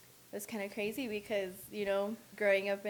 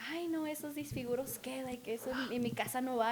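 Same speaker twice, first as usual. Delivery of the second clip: disapproving grandma; 4 semitones higher than usual. Retold or imitated. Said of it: "she was like".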